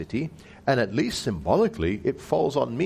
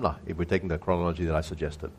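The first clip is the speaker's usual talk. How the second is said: ordinary talk, nothing marked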